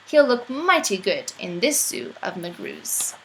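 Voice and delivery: ordinary delivery, nothing marked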